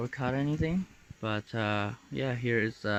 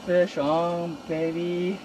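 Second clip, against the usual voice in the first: higher and louder, thrilled; excited; 6 semitones higher, 3 dB louder, slower than usual. Real, not acted. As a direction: call it out with joy. Fish hooked, big reaction.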